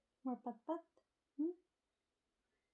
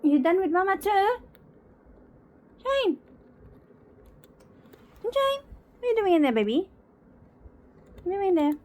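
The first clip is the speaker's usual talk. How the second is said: calling out; 5 semitones higher, 9 dB louder, faster than usual. Real, not acted. Calling out loudly to pet.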